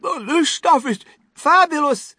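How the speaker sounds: put-on high, loud gluttonous boy voice; delighted high-voiced boy, mouth watering; greedy boy. A narrator's reading. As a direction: relish it as a greedy child. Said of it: "said Augustus".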